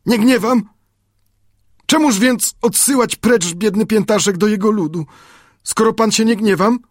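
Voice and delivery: put-on high, broken-Polish native boy, questioning; Friday, confused and hurt. A narrator's reading. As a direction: plead high, puzzled and wounded. Friday voiced by narrator. (wysoki głos Piętaszka, zmartwiony)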